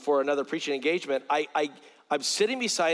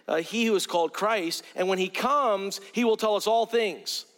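raised, measured Bible reading voice; scripture reading; 4 semitones higher than usual. Acted. Preacher reading scripture to his congregation, raised and measured. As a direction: read the verses out to the flock, a little lifted. Preacher reads John 4 aloud.

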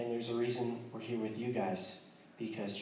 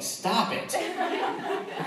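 mock-annoyed outburst, higher and louder; 13 semitones higher, 4 dB louder, much slower than usual. Annoyed missionary pretending to scold generous people, mock outburst. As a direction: playfully snap at the givers to stop. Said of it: "you start getting annoyed".

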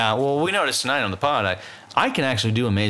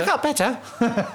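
high, slow sheepish peasant; film scene quote; 10 semitones higher, slower than usual. Acted. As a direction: draw out the punchline in imitation. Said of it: "Remember that scene where he's like".

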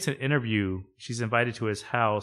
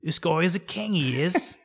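put-on higher-pitched comic English accent; mock British accent; 6 semitones higher than usual. Imitating an Englishwoman amazed by her boss, mock British accent. Imitating sitcom character from England.

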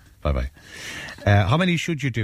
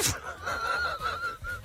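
helpless high-pitched laugh; high squealing laugh; 10 dB quieter than usual. Real, not acted. Cracks up at own saying.

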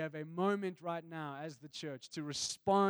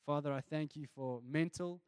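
prayer; 3 semitones lower than usual. Acted. Closing prayer over listeners.

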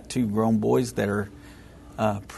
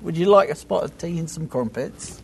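hammed-up British accent, pitched higher; 6 semitones higher than usual. Texan host showing off his British impression to his co-host, in a mock British accent. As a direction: do your best mock British tea offer. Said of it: "that's the best I can do".

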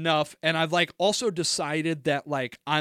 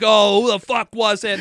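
loud, higher retold outburst; angry cursing; 4 semitones higher, 8 dB louder than usual. Retelling their angry demand to the roommate, sailor-style cursing. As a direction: swear the question out like an angered sailor. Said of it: "I immediately become a fucking sailor".

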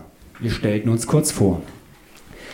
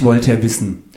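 usual speaking voice

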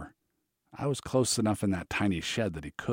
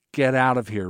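stern order; 4 semitones higher, 7 dB louder than usual. A narrator's reading. Eric orders him out again.

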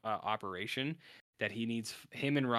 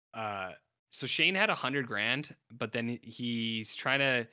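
ordinary talk

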